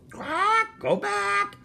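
piercingly high talking bird; parrot squawk. A narrator's reading. Parrot warning boy by the chest, squawking. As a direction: screech it like a parrot. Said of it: "squawked Polly".

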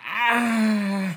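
1 mock groan of pain. Man in ad hurting his back.

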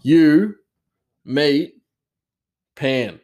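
broken kitchen English, word by word. Shown as a demonstration. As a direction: bark out single key words. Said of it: "the key points of the words".

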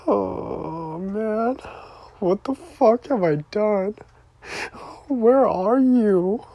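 high-pitched, anguished wail; wailing through real tears; real crying. Real, not acted. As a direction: cry it out, drawn out at the end. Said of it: "Why am I crying".

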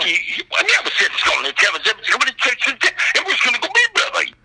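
high, rushed, garbled Southern-Creole babble; fast gibberish in a strange Southern accent. Retold or imitated. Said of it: "this guy had the strangest accent".

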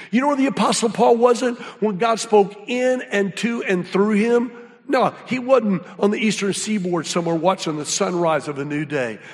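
ordinary talk, nothing marked

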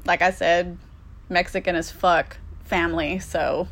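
usual speaking voice

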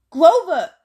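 loud cry of Grover; boy shouting to his friend slumped in the car; alarmed call. Acted. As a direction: call out to wake your friend. Boy calls unconscious friend.

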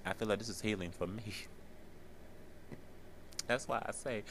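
real tears, words barely held together; crying softly. Real, not acted. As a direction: speak through quiet tears. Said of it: "I'm sorry for crying on y'all".

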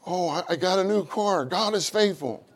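usual speaking voice

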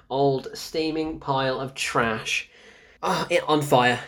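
normal talking voice

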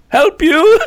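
high, loud mock shout; James shouting back. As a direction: throw the question back at full volume. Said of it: "And James went".

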